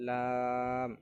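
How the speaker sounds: লাম held long on one even note, loud; recitation demo. Shown as a demonstration. Teacher has learners repeat after him, drawn-out recitation. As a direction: draw out the vowel of লাম evenly. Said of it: "আমার সাথে সাথে পড়ার চেষ্টা করেন".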